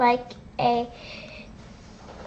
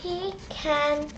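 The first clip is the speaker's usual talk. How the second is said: plain reading voice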